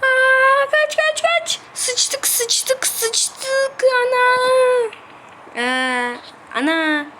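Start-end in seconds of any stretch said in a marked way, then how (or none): 0.0-1.6 high frantic shouts to run; panicked yelling. Real, not acted. Panik içinde kaçış çığlığı. 1.7-4.9 panic. Real, not acted. Oyunda panik.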